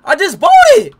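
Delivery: loud exasperated insistence; real frustrated yell; frustrated shout. Real, not acted. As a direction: yell it in disbelief. Streamer insists he paid.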